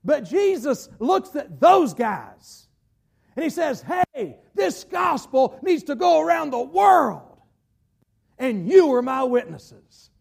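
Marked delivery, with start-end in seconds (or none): 4.1-7.4 high, forceful preacher's shout; raised voice, proclaiming to the disciples; shouted commission. Acted. Acted command of Jesus, preached loudly. 8.4-10.1 loud, slow "you", then falling away; weighty declaration stressing "you"; emphatic charge. Acted. Acted command of Jesus to disciples.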